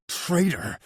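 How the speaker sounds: high, loud spat-out word. A narrator's reading. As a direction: spit the last one out. Repeated word rising to anger.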